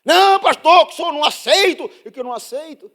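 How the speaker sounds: hammed-up furious church lady; indignant sister. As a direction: protest with outrage. Angry sister refusing.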